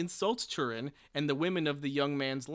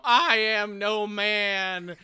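slow, grand and much louder; heroic declaration; 5 semitones higher, 9 dB louder, much slower than usual. Pretend. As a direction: proclaim it like a warrior unmasking. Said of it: "you whip off your dragon helm and you go".

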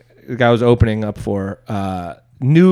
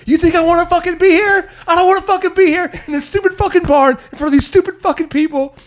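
high, loud furious rant at the crowd; comic re-enactment of losing it on stage; retold meltdown rant; 3 dB louder than usual. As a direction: snap and let it all out. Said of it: "lose my mind".

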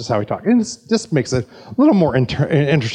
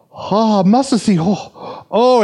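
higher excited imitated Korean accent; South Korean accent; 8 semitones higher, 3 dB louder, slower than usual. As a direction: gush over the seed in a Korean accent, up high. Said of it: "you like my South Korean accent".

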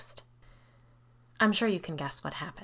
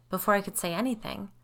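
usual speaking voice

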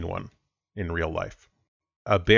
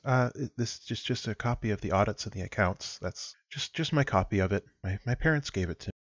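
timid Carla; 6 dB quieter than usual. Acted. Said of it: "And she says".

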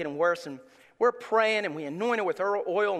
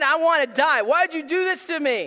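Job's despair; 8 semitones higher, 7 dB louder than usual. Retold or imitated. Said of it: "he just said".

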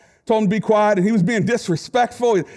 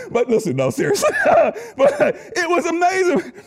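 laughing; 9 semitones higher than usual. Real, not acted. Pastor telling the congregation, laughing through it. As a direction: laugh while saying it.

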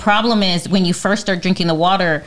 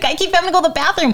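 own quote; 10 semitones higher, much faster than usual. Retold or imitated. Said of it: "It's like".

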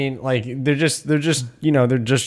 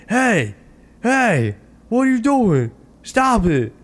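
high-pitched mock calling out, each phrase falling; high, repeated pleas without real shouting; mock coach protesting; 10 semitones higher than usual. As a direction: imitate a useless coach calling for calm. Coach ineffectually calling out stop.

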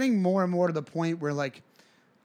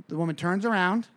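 ordinary talk

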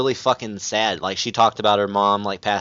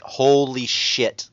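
slow, stretched holy shit; drawn-out awe; 2 semitones higher, much slower than usual. Retold or imitated. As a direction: let each word hang in amazement. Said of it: "I was like".

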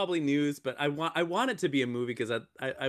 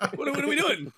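high, louder exasperated complaint; exasperated John Cusack; 11 semitones higher, 4 dB louder, faster than usual. Retold or imitated. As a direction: throw up your hands in disbelief. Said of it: "and he's like".

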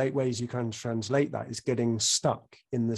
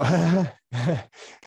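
short amused laugh; 5 semitones higher, 6 dB louder than usual. Real, not acted. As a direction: burst into a laugh. Laughs at being asked.

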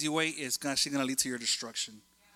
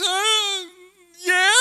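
high, loud mock toddler wail; exaggerated tearful little-kid whine; imitated toddler crying; 10 dB louder than usual. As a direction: blubber a yes like a crying two-year-old. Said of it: "why he was crying".

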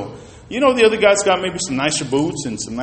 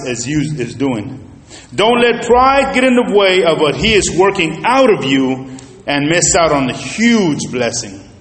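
ordinary talk

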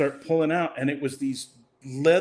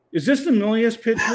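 insistent; 7 semitones higher, 4 dB louder, faster than usual. Retold or imitated. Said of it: "He's like".

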